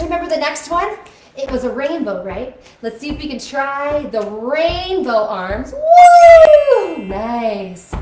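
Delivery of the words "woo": high, loud whoop; excited whoop. Real, not acted. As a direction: whoop with glee at the rainbow arms. Rainbow arms cheer.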